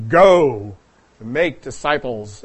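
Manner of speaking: loud bellow on GO, then slow; bellowing missionary. Retold or imitated. Retelling missionaries bellowing to the congregation, slow loud command. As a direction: boom out the command like an old-school missionary. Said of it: "bellowing out, you know, in strong, deep baritone voices".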